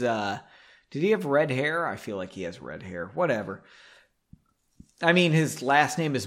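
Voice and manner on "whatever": ordinary talk, nothing marked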